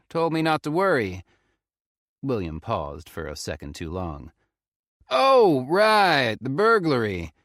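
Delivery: Southern ranger stalling. A narrator's reading. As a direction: cover up with forced cheer. Ranger covering, awkward laugh.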